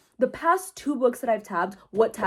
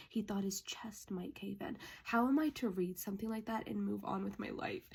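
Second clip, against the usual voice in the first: very soft, low, drained voice; devastated hush; 3 semitones lower, 13 dB quieter than usual. Real, not acted. Reader undone by a romantic passage, devastated hush. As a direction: read it hushed, barely above a murmur.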